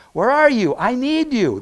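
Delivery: loud, high cry for help to God; yelled plea. Acted. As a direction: yell up at God, pleading for an answer. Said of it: "God would rather hear us yell".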